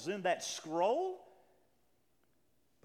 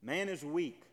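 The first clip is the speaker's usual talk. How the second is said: ordinary talk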